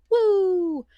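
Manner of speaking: high, loud retold cheer; excited whoop. Cohost tells of finding something exciting, playful whoop. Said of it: "found and was like".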